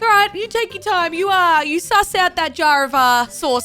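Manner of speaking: politely seething, waiting; passive-aggressive politeness. Retold or imitated. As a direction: fake patience at a shopper blocking the shelf. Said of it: "you're just like".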